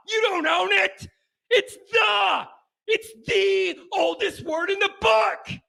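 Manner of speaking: shouting rant. Real, not acted. Radio host yelling at Ohio State fans that they don't own the word. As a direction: yell it at them, exasperated.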